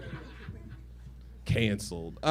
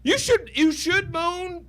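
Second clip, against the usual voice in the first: playful high urging; 12 semitones higher, slower than usual. Pretend. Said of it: "urging audience to moan".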